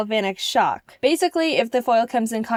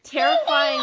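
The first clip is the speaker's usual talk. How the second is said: squealed high; 4 dB louder than usual. Real, not acted. Toy scaring her.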